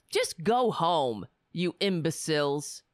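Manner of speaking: usual speaking voice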